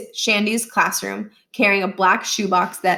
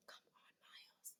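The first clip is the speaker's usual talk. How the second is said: breathy aside under the breath; whispered self-pep; 44 dB quieter than usual. Acted. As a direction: urge yourself on in a whisper. Miles whispering to himself.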